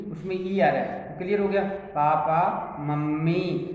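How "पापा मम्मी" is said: पापा said very slowly with a long final आ, then मम्मी with a long final ई; teacher stretching पापा and मम्मी so the ending vowels ring out; drawn-out vowel demo. Shown as a demonstration. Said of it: "जब पापा बोलेंगे तो उसमें आ की ध्वनि आ रही है".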